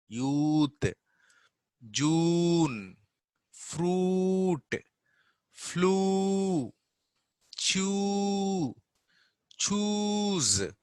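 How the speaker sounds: lose, prove, youth with a high, stretched oo; long oo. Shown as a demonstration. Teacher drills long oo words, high and stretched. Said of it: "you can expand it".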